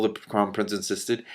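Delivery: usual speaking voice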